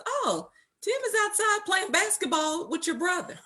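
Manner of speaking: high-pitched, lightly amused imitation of mom; imitated mother. Wife imitates her mother reporting on her husband, high and breezy.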